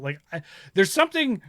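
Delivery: ordinary talk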